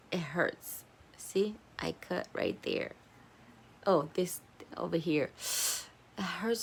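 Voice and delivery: whispered, hushed; whispering. Real, not acted. Girl whispering while showing her cut finger. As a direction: whisper it close to the mic.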